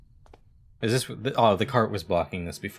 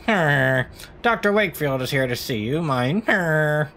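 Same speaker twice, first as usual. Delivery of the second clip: loud goofy mock reading; weird noise; 8 semitones higher, 4 dB louder than usual. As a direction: blurt HER as a weird noise, loud and high. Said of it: "like, a weird noise like".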